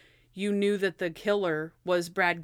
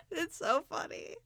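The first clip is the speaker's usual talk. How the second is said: normal talking voice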